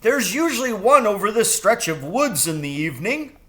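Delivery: very high, loud bird exclaim; delighted crow. A narrator's reading. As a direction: cry it out gleeful. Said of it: "he said".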